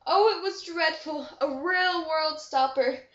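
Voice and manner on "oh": ordinary delivery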